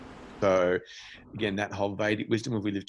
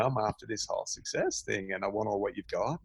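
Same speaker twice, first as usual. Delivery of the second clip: ordinary talk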